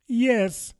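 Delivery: very high, hesitant yes; doubtful. A narrator's reading. Alice answers the griffin about beautify, doubtfully. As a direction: pitch it up, unsure. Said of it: "said Alice doubtfully".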